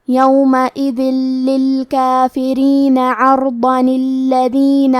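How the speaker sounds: عرضا الذین joined, the hidden ن given a zer, said slowly; tajweed demo. Shown as a demonstration. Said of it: "زیر سے پڑھتے ہوئے پھر کلمت الذین کو پڑھنا ہے". (عرضا الذین ملا کر، نون زیر کے ساتھ)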